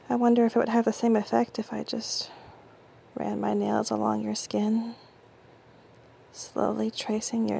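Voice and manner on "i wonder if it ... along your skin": normal talking voice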